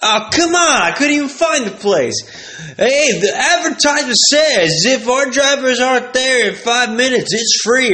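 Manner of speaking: high, slow mock protest; hammed-up customer demanding a free pizza; indignant customer. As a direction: complain the driver's late. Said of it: "He's like".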